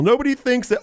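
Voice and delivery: ordinary delivery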